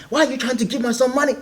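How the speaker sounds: put-on indignant mum in a rough Nigerian accent. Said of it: "in a Nigerian accent".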